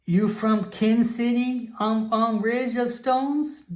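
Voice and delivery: drawn-out wondering grandmother voice; high, slow old frog shaman. Acted. Grandmother shaman questions the goblin, high and slow. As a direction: puzzle over where he came from, slowly.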